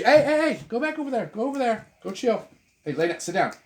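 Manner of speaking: sharp, high, loud commands to a dog; scolding the dog. Real, not acted. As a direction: shoo the dog off, voice jumping up. Scolding dog away from tripod.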